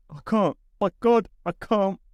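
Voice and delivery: high, strained plea of exhaustion; worn-out, high-voiced despair; desperate protest. A narrator's reading. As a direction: plead that you cannot get up, pitched high. Exhausted man fails to rise.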